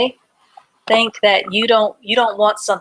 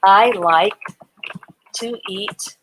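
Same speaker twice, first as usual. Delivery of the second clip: words given one by one with long gaps; slow dictation. Shown as a demonstration. Teacher dictating a sentence for children to copy. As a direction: dictate slowly while writing. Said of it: "Let me, uh, write that in a sentence".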